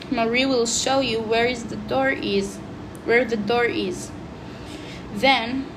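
reading a story aloud for class; a pupil reading the book out to the class; story narration. A narrator's reading. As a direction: read it like a schoolbook passage. Reads story excerpt aloud.

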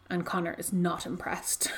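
dry whispered aside, breath only. Real, not acted. Telling her co-host the boy is unimpressed, in a dry whisper. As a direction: drop to a whisper for the punchline.

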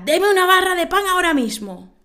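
high, loud mock-angry customer demand; angry shout. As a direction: bellow it at the baker. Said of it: "le grita".

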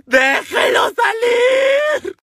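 very high, slow crying yell; mock wailing shout. Boyfriend bawling from outside the cell, high wailing shout. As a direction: wail it like he's bawling. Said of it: "se oían los llantos".

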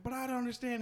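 high, soft imitation of a confused woman; retold protest. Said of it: "and she's like".

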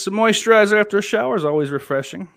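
bright mock-ad delivery of a comment; perky reading voice. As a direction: read it like a cheery ad. Reading viewer comment aloud.

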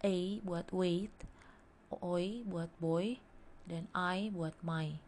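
slow, stretched vowel examples; drawn out for the class; slow model sounds. Shown as a demonstration. Teacher modelling diphthongs slowly for students.

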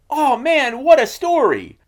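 high, loud amazed exclaim; excited crowd. Retold or imitated. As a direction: gasp it out in delight. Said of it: "And everybody's like".

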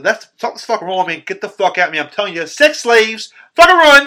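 urgent high voice building to a shout; alarmed warning. Retold or imitated. Rod warns his friend on the phone, frantic shouting.

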